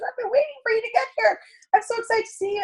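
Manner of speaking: high, gushing excited voice; excited hostess. Retold or imitated. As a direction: squeal it out like a thrilled friend.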